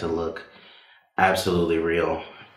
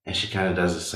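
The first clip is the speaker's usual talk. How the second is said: usual speaking voice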